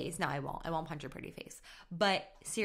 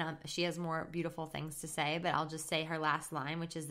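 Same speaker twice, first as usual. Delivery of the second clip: ordinary delivery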